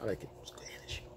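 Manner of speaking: hushed, savouring approval; near whisper. Retold or imitated. Man goading the angry woman at the bar, whispered. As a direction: let it sink to a breath of a whisper. Unbothered reply to her anger.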